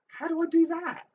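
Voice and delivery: high-pitched incredulous question; mock bafflement. Former child actor asking about bum pinching, mock bafflement. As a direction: squeak it in disbelief.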